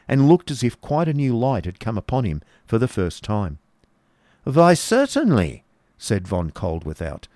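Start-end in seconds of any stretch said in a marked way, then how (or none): 4.4-5.6 high, slow, emphatic assent. Acted. Baron argues back brightly with genius.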